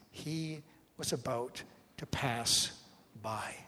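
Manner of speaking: slow, pausing between words, falling away at the end; measured Gospel reading. Acted. Preacher quoting the Gospel writer to the congregation, slow and measured.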